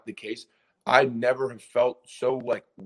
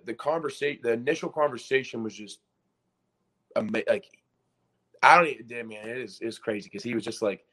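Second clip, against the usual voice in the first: ordinary talk, nothing marked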